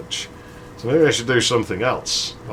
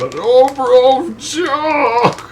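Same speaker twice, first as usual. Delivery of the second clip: much higher, loud anguished wail; crushed friend's anguished cry; 14 semitones higher, 6 dB louder, much slower than usual. Retold or imitated. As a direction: wail like your dreams just got crushed. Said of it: "you could hear his dreams been crushed".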